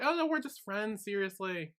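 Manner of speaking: high, light imitation of protest; placating denial. Maggie interjecting to reassure Nung, high placating denial. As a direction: pitch it up to smooth things over. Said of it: "And Maggie interjects".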